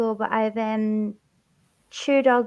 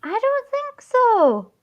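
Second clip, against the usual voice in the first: mock denial; 11 semitones higher, slower than usual. Real, not acted. Playful denial in accent argument.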